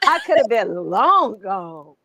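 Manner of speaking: ordinary delivery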